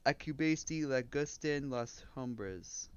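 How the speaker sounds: slow, soft Spanish in an English accent. Real, not acted. Streamer checking if he said it right, English accent. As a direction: sound out Spanish with English vowels. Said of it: "saying it with an English accent".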